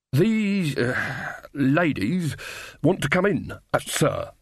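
halting, searching for a polite word; hesitant corporal. Acted. Corporal uneasily reporting the witches to his captain, hesitant. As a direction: hesitate around the awkward word.

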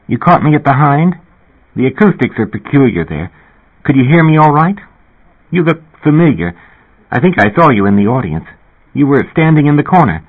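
Linda Fox. Acted. Narrator voices Linda Fox.

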